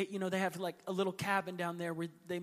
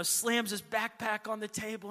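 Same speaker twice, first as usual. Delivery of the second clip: ordinary talk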